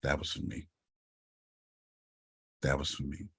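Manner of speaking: soft, low hushed aside to wife; quiet aside to his wife in church. Retold or imitated. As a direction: lean over and murmur it under the breath. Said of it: "I said".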